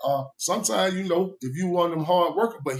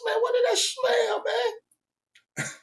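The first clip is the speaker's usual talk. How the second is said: high, astonished voice; amazed question; much slower than usual. Retold or imitated. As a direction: act floored by a smell in the room. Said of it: "I said".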